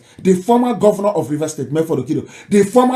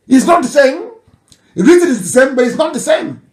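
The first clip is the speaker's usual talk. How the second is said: higher and louder, stress on not; emphatic insistence; 7 semitones higher, 4 dB louder than usual. Real, not acted. Commentator insisting dialects differ, emphatic. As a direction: hammer the word not.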